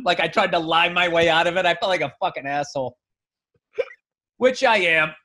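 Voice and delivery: ordinary talk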